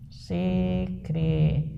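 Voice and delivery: Secretária broken slowly into separate syllables, last one detached; split into syllables. Shown as a demonstration. Teacher shows students a wrong division, syllable by syllable. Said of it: "dividido secretária assim, ó".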